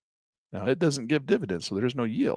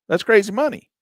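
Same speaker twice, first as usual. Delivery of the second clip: That's crazy money, said higher and louder; excited exclamation; 10 semitones higher, 7 dB louder than usual. Shown as a demonstration. Vlogger hypes returns to viewers, higher, louder exclamation. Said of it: "repeated with rising emphasis".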